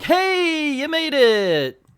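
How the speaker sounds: loud, very high, stretched-out greeting; hammed-up hooray, drawing out the words; mock cheer. The others greet teammates at the temple, loud mock cheer.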